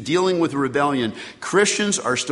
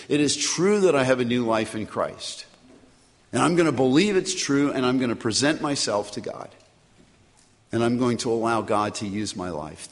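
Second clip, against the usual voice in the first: closing prayer; 3 semitones lower than usual. Acted. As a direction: pray on behalf of the congregation. Pastor leads closing prayer.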